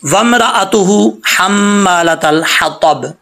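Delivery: slow tajweed reading, long hum on the mm of हम्मालतल; measured recitation, clear break in वमराअतुहु; verse recitation. Shown as a demonstration. Teacher recites the next verse. (तजवीद से ठहरकर तिलावत)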